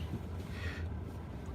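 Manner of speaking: long, quiet exhale; heavy breath out; weary sigh. Real, not acted. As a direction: let out a soft, drawn-out sigh. Weary sigh before answering.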